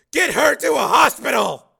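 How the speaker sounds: high, very loud, drawn-out order; urgent shout. Acted. Father sends wounded daughter to hospital, urgent shout. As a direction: bellow a desperate order.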